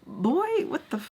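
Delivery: put-on high shocked boy then drop; shocked squeal.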